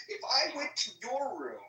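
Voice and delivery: normal talking voice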